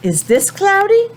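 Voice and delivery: high rising playful question; singsong question to children; high questioning teacher voice. Shown as a demonstration. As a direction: ask it up high like to little kids.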